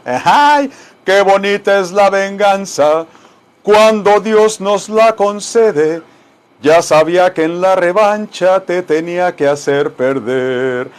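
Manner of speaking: loud, slow, dramatic mariachi-style declaiming; ranchera declamation. Acted. Teacher hamming up a ranchera for students. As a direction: belt it out like a cantina singer.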